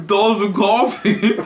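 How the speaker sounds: laughing. Acted. Mock podcast outro, laughing.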